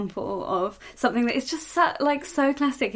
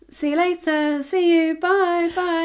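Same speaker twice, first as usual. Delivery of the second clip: cheery goodbye; 7 semitones higher, 5 dB louder, slower than usual. Retold or imitated. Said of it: "you might say".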